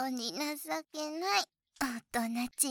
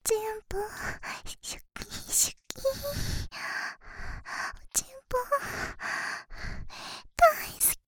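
cutesy moe moaning; 10 semitones higher, faster than usual. Pretend. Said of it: "萌え声で、耳元であえいであげます".

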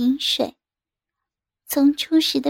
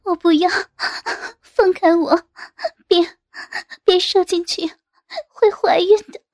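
pleading, higher-pitched protest between gasps; acted gasping plea, pitch climbing toward the end; distressed cry; 4 semitones higher than usual. A narrator's reading. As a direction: cry out in panic, breath catching between phrases. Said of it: "大叫道".

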